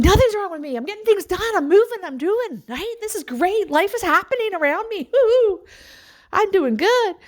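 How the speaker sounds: high, excited, bubbly defense; hyped-up self. Retold or imitated. Mom answering her family, high and excited. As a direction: bounce it back at the family, high and thrilled.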